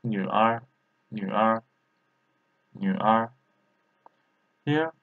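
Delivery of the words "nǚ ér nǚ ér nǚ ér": "Nu R" with "Nu" in a low half third tone; "Nu R" as Mandarin, half third tone first. Shown as a demonstration. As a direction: drill "Nu R", half third tone then er. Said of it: "half third tone".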